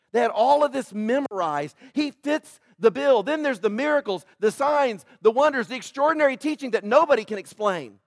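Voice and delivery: loud, rising pulpit preaching; fiery sermon climax; full-force preaching. Acted. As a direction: drive it home to the congregation, high and loud.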